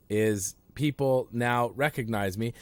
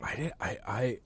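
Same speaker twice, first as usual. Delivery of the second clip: whispered, sheepish start; hushed confession; 5 dB quieter than usual. Real, not acted. Confessing to the host he yelled at his kid, whispered. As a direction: whisper it like a guilty admission. Admitting the bad stuff.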